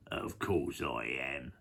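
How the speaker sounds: low, soft, slow reply; smug crocodile. Acted. Crocodile confirming it to the hippo, low and soft. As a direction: drop it low and unhurried. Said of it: "said the crocodile".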